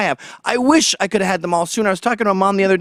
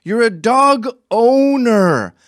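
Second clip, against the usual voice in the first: slow, high, drawn-out dog owner; emphatic correction; 6 semitones higher, 5 dB louder, much slower than usual. Real, not acted. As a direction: lean hard on dog owner to correct her. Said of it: "There's no such thing as a dog mom".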